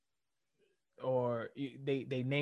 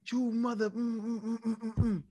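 high, bleeped-out mock yelling; mock coach yell; 7 semitones higher than usual. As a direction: imitate a coach yelling at a kid, words hummed out. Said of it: "if you yell at a kid".

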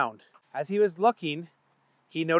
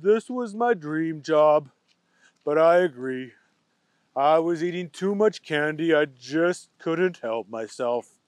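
sad. Acted. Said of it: "Booger felt sad".

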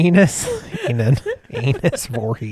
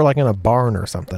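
ordinary delivery

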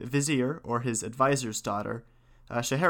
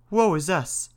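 higher-pitched lament of a tailor; dismayed cry; 6 semitones higher, 4 dB louder than usual. A narrator's reading. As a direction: cry out in mild storybook dismay. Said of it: "cried the tailor".

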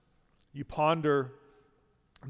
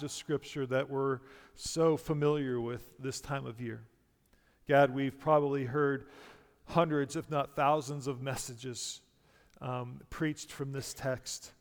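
prayer; 5 dB quieter than usual. Real, not acted. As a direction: pray softly, dropping quieter at phrase ends. Leads the congregation in prayer.

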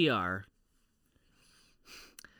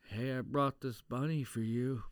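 low, soft film-scene imitation; understated mock-earnest gift-giving; mock movie-hero delivery; 4 semitones lower, 6 dB quieter than usual. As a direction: lightly act the tender hero, low and soft. Acts out Con Air bunny scene.

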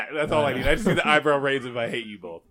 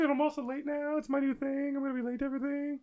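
high mocking voice, fast at end; mocking Justin voice; 8 semitones higher, 6 dB quieter than usual. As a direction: pitch up and mock him. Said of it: "[name], do it in that voice".

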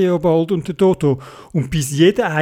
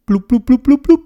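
quick high "blub" bubbles; imitated bubbles; 9 semitones higher, 4 dB louder, faster than usual. A narrator's reading. Theobald finishing his underwater speech, high bubbling noises.

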